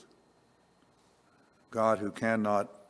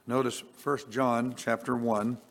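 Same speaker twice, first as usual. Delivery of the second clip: usual speaking voice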